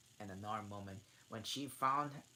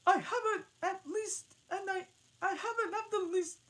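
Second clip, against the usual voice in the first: squeaky pigeon voice; 11 dB louder than usual. Acted. Alice line in pigeon voice.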